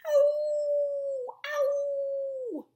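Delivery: high, loud mock wolf howl. Shown as a demonstration. Teacher showing a child the wolf's sound, loud howl. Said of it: "what sound a wolf makes".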